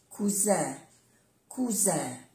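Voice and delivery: "cousin" with a soft, voiced z. Shown as a demonstration. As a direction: say "cousin" with a buzzing z in the middle. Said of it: "se pronuncia como una Z suave".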